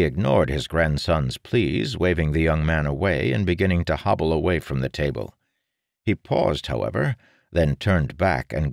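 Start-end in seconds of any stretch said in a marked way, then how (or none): none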